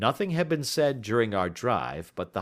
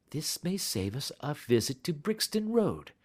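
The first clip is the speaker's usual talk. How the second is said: ordinary delivery, nothing marked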